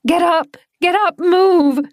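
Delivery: high, loud commands, rising to the last; desperate screaming. Acted. Claire screaming at Doran to get up, loud high commands. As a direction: scream at him to move.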